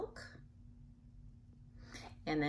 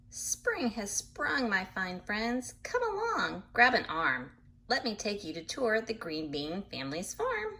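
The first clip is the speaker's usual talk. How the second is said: lively storybook reading voice; animated picture-book narration; reading to children; 4 semitones higher than usual. A narrator's reading. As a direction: read it bright and sing-song for little listeners. Reads book aloud to children.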